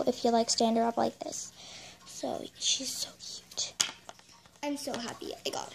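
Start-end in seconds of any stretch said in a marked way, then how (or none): 2.0-3.7 whispered cooing. Real, not acted. Cooing over the new pet.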